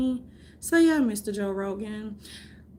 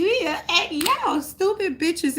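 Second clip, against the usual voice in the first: mocking insult; 8 semitones higher than usual. Mocking the public.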